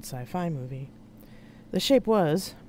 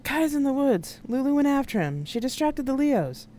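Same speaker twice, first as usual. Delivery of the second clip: high raised voice over engine noise; pitched up, calling across noise; shouted reply; 10 semitones higher, 4 dB louder than usual. Acted. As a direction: shout back an urgent report. Answering over the ship's noise.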